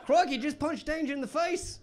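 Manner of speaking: high, hammed-up Australian accent. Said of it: "That was the best accent I've ever done".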